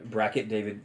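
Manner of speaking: ordinary talk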